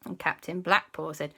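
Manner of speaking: ordinary delivery, nothing marked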